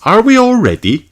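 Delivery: high, bright mother checking; cheerful mum rounding up; Mummy Pig voice. Acted.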